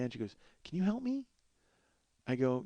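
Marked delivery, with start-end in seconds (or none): 0.6-1.2 imitated high-pitched plea; wheedling request. Neighbour poking her head in to ask for help, wheedling. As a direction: put on a small, high favour-asking voice.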